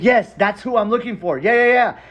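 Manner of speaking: high, eager burst of agreement; retold thrilled yeses, ending loud; excited confirmation. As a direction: confirm it eagerly, pitched right up. Retold eager recognition of uncle's name.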